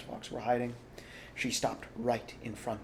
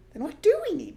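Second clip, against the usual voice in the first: very high, louder little cub; small fox cub voice; 10 dB louder, faster than usual. A narrator's reading. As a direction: squeak it like a tiny eager cub.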